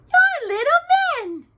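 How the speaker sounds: put-on high girlish surprise; surprised princess. A narrator's reading.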